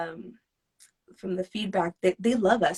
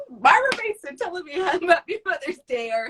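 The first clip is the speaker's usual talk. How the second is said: giddy; 8 semitones higher, faster than usual. Real, not acted. Fan disbelief, laughing.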